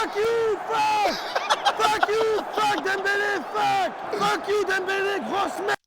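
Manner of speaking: high, shouted curses; furious cursing at the screen; angry cursing. Real, not acted. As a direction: swear at the player at full voice. Cursing Dembélé after goal.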